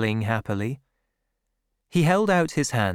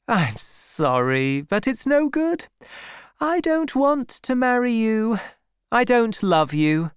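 put-on lightly raised young woman's voice, apologetic then firm; a hint of a young woman turning down a proposal; Bathsheba refusing; 9 semitones higher than usual. Young woman turns down Gabriel's proposal, lightly voiced and firm.

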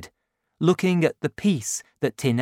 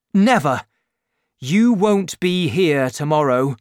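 louder, insistent "Never!"; emphatic refusal; 3 semitones higher, 6 dB louder than usual. A narrator's reading. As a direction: refuse him outright. Marius refusing Valjean's leaving.

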